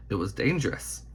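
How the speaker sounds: ordinary delivery, nothing marked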